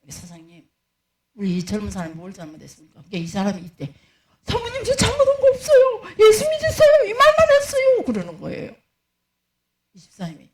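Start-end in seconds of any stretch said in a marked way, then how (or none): 4.4-8.0 put-on high, loud protesting young man; young deacon crying out he did nothing wrong; whiny young deacon. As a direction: plead your innocence, high and loud like a wronged kid.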